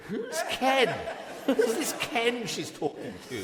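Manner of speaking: hammed-up posh puzzlement, laughing; puzzled English students. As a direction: mimic baffled English students through laughter. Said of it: "they're all looking at me going".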